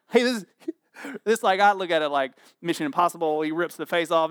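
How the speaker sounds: ordinary delivery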